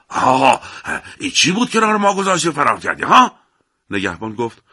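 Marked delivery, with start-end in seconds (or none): 0.1-3.3 put-on loud rising angry old man; old villager shouting. A narrator's reading. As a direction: confront him, voice climbing. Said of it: "پیرمرد روستایی فریاد کنان رسید". (پیرمرد روستایی با فریاد و عصبانیت)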